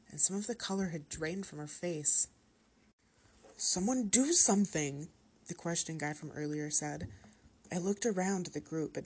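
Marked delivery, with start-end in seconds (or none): 3.5-5.1 louder, higher, urgent cry for help; alarmed plea. A narrator's reading. Question guy as Claire seems to choke, alarmed plea. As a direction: call out urgently to the group. Onlooker alarmed at choking girl.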